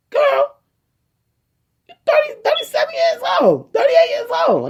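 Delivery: high, loud scandalised mom; shocked mother shouting. Retold or imitated. Mom reacting to pregnancy news.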